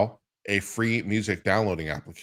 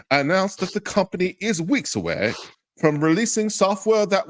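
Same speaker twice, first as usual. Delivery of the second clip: higher, pumped-up announcer read of the release; mock press-release hype; 7 semitones higher than usual. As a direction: sell it like a cheesy promo to mock it. Reads hyped press release mockingly.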